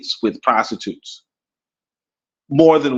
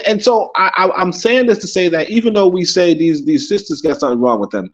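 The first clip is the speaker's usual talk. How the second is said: normal talking voice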